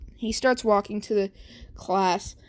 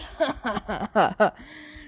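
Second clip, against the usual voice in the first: mocking laugh. Bakugo laughs at Deku.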